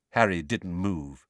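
usual speaking voice

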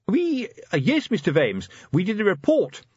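high, nervous stammer; flustered. A narrator's reading. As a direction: caught out, voice jumping up. Colon caught out, flustered.

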